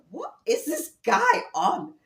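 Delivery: high, slow incredulous what-is-he-on; mock bewildered onlooker; baffled stare. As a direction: drawl it out like you can't believe him. Strangers staring, imagined thought.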